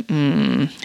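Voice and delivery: ordinary delivery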